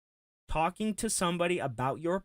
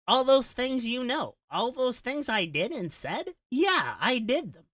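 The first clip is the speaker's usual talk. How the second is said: high, loud mock voice of Phil; co-host mocks Phil confessing, in a high voice; mock confession; 8 semitones higher, 3 dB louder than usual. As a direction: put words in his mouth, hammed-up.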